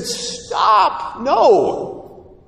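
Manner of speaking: high, loud cry to stop; urgent protest. Acted. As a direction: call out to halt someone, high and forceful. Acting out urgent protest to widow.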